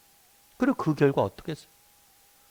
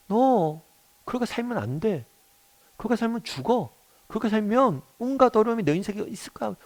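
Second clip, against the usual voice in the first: higher-pitched, mildly scolding warning; imitated angry warning; 7 semitones higher than usual. God warning people out of love, imitated scolding. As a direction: warn like a worried, scolding parent. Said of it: "분노하시는 모습들을".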